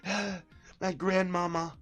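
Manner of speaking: high, put-on voice mocking his whisper; mock hushed imitation. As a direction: mimic him trying not to be overheard. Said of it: "he had to, like, whisper".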